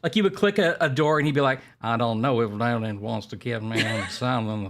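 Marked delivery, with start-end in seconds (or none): 1.8-4.7 put-on New Orleans accent. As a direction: mumble it like a hunky New Orleans man. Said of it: "a hunky man mumbling in a New Orleans accent".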